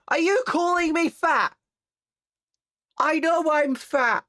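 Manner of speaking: high squeaky indignation, hammed-up; mock offended. Streamer fakes hurt when chat moos at her. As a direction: play it wounded and cartoonish.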